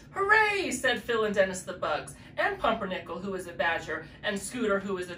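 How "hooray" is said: high, loud hooray; cheer. Acted. Garden friends cheering the wedding news, high cheer. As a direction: cheer with delight.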